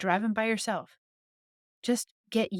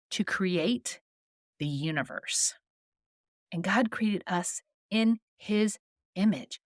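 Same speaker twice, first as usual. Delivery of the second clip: ordinary talk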